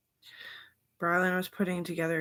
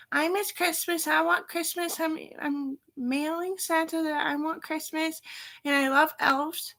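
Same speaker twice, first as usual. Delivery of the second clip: put-on much higher, louder whiny child voice, slow at the end; a small niece longing for Christmas; little girl voice; 11 semitones higher, 6 dB louder than usual. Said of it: "She's all like".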